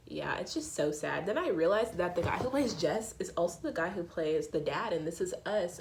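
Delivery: ordinary talk, nothing marked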